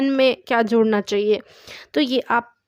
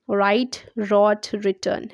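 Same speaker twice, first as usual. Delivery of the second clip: slow demo; 3 semitones lower, much slower than usual. Shown as a demonstration. Teacher models verb forms.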